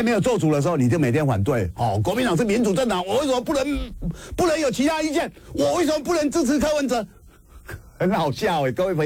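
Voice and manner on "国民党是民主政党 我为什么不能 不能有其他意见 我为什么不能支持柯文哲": high, whiny mock complaint, mimicking the dissenters; mocking whine. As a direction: pitch up and whine as if aggrieved. Mocking party dissenters' whining complaint. (尖声模仿抱怨)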